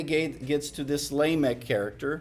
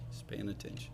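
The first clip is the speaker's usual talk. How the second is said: 1 hushed emphasis; 6 semitones lower, 16 dB quieter, faster than usual. Real, not acted. Soft emphatic repeat.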